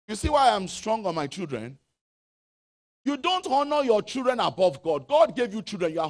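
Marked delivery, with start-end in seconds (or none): none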